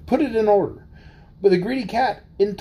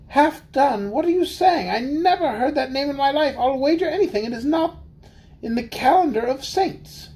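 high, baffled little mouse; astonished mouse; 6 semitones higher than usual. Acted. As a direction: exclaim it in disbelief.

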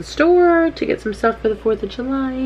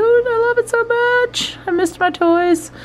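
high, slow gushing over toys; cutesy excited; 3 dB louder, slower than usual. Real, not acted. Gushing over her toy wall.

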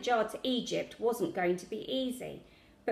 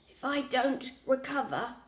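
weak sick-grandad croak, higher; croaky; 4 semitones higher than usual. A narrator's reading. Sick grandad fearing the worst to Lily, croaking. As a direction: croak it out haltingly. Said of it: "Grandad croaked".

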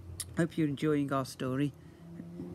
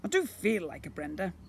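booming slime creature; 8 semitones higher than usual. Acted. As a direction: play the magical blob, grand and eager. Slime voice offering names.

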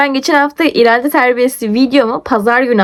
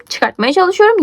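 ordinary talk, nothing marked

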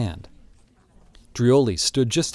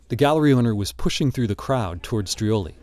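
ordinary delivery

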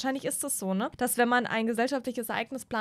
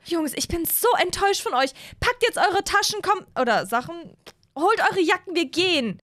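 higher-pitched disappointed mother scolding; higher mom voice telling the boys off; 7 semitones higher, 4 dB louder than usual. Acted.

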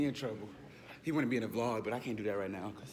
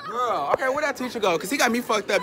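fast, louder playful rant; mock indignant rant; 8 semitones higher, 6 dB louder, faster than usual. Real, not acted. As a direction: sass it out fast. Ranting about teacher.

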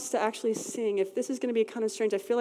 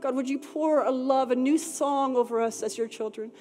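louder, higher pleading worship prayer; fervent prayer; 3 semitones higher, 3 dB louder than usual. Acted. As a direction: cry out to God with passion.

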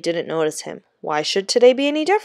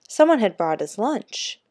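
ordinary delivery, nothing marked

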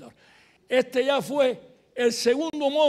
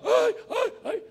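repeated high "ay" yelps; imitated cries; 13 semitones higher, 3 dB louder, much slower than usual. Student unable to speak, only crying out, imitated high yelps. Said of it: "empieza a gritar".

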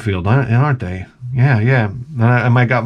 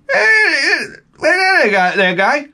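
very high mock older local; Cliff Clavin-like impression; 14 semitones higher, slower than usual. Buddy's father answers a visitor, very high caricature voice. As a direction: exaggerate the buddy's father's greeting. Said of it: "he would say, "Guy".

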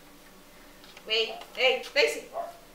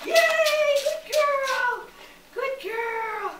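excited praise; 6 semitones higher than usual. Real, not acted. Praising dog after weave run.

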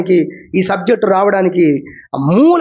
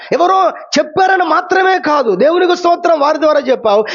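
preaching shout; 4 semitones higher than usual. Real, not acted. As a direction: drive the point home to the church.

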